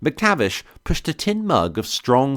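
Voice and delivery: plain reading voice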